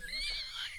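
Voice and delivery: high squealing laugh; burst of laughter. Real, not acted. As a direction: break into a high, airy laugh. Laugh after the retold quarrel.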